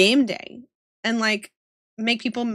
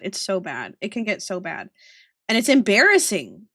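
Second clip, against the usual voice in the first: ordinary talk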